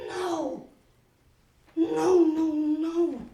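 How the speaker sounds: loud, high, panicked no's; horrified denial. Acted. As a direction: push the thought away, loudly. Horrified at her own thought.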